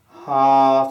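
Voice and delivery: loud, open-throated "ah" as in car; yawn-open throat, sustained level "haa". Shown as a demonstration. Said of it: "the vowel sound R as in car".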